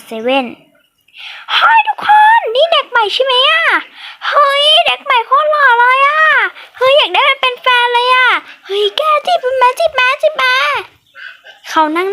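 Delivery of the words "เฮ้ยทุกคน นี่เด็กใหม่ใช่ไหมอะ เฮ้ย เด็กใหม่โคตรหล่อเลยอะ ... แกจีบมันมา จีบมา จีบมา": put-on high, loud gushing girl voice; giddy girl shouting to her friends; excited schoolgirl. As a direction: squeal over the cute new boy. Schoolgirls gossip about new boy.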